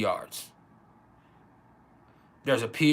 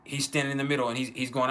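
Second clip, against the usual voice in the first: usual speaking voice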